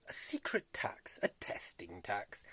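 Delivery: hushed, secretive whisper; conspiratorial whisper. Acted. Telling viewers about the secret stealth tax, conspiratorial whisper. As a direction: whisper it like classified information.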